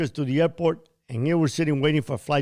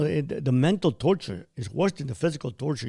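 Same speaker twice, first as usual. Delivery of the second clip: ordinary talk, nothing marked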